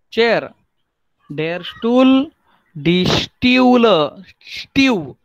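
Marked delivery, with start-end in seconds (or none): 2.7-5.2 Stühle slow and loud, stretched "shtyoo-luh"; twisted U demo. Shown as a demonstration. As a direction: draw out the twisted "yoo" in Stühle. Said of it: "यू को हम लोगों ने pronounce कैसे किया है". (Stühle में "यू" को खींचकर, धीरे)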